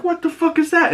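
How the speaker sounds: high mock shocked outburst; shocked dad. Joel spotting tattoo gun, high shocked yelp. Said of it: "and he's like".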